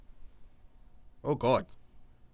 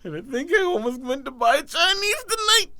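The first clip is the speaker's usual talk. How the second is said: high-pitched, laugh-choked voice; helpless squeaky near-laughter; holding back laughter; 10 semitones higher than usual. Real, not acted. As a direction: squeeze it out while cracking up. Talking while cracking up.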